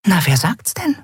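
high little voice, confiding; whispering friend. A narrator's reading. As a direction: tease your friend in a high, small voice. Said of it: "flüsterte Nelen ihrer Freundin zu".

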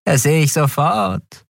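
a conspiratorial dealer sizing up strangers, fluting. Acted. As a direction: pipe the words out sweetly and slyly. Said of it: "flötete der Mensch".

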